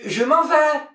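very high little cry; harried wife. Acted. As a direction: call back in a fluster. Wife answers the shouting husband.

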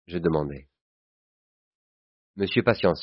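ordinary delivery, nothing marked

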